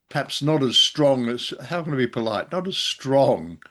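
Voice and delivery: normal talking voice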